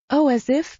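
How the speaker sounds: a haughty heiress brushing him off; sniffy. A narrator's reading. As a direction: toss it off with disdain. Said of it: "Tabitha sniffed".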